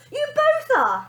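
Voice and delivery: very high, loud snap; nanny snapping at both; snapped. Acted. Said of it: "snapped the lady".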